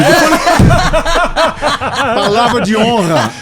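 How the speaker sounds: loud, high voice through laughter; laughing. Real, not acted. Father telling how his sons echoed each other, laughing loudly. Cracking up at punchline of own story.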